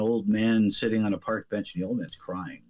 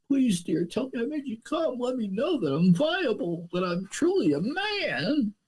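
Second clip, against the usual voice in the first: much higher, whiny mock begging for reassurance; pleading young man; 10 semitones higher than usual. Speaker mocks a young man begging his lover, high and whiny. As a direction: beg her to say you're a real man. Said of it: "I know all the problems of younger boys".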